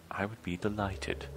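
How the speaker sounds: soft, subdued acceptance; quiet polite reply. Acted. Troll accepts the invitation to the library, quiet and polite. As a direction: answer politely under the breath. First read of dialogue option.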